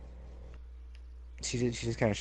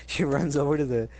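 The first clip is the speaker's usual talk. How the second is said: usual speaking voice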